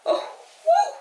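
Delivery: high, tearful voice easing down from a wail; real crying. Real, not acted. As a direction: plead through real tears, breath escaping in "woo". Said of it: "I'm always crying on camera".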